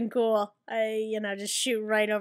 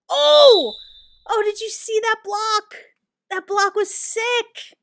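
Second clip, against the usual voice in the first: high, loud burst of excitement; excited gamer yell settling into high bragging; thrilled hype; 9 semitones higher, 8 dB louder, slower than usual. Real, not acted. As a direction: crow about a great play. Thrilled at own block.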